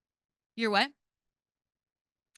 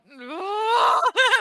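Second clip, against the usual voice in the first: loud high burst of laughter; real loud laughing; 13 semitones higher, 9 dB louder than usual. Real, not acted. Big laugh at own joke.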